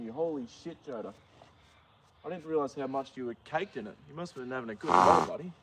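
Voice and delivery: quiet and gentle, fussing over a muddy horse; soft talk to horse. Real, not acted. Groomer softly talking to his muddy horse, gentle. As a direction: murmur to him like a fond owner.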